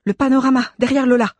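high, loud, excited exclamation; urgent discovery. A narrator's reading. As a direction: point it out urgently. Nanès spots a clue, urgent. (exclamation pressante, aiguë et forte)

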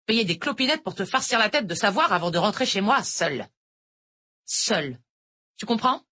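hard voice. A narrator's reading. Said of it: "d'une voix dure".

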